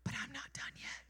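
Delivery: hushed breathy whisper. Pretend. God whispering a promise to Ruth. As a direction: lean in and breathe it softly. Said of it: "God would whisper".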